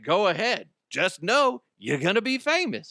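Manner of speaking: high, cocky challenge; retold taunting dare. Clerk pointing boyfriend to the camera, high taunting dare.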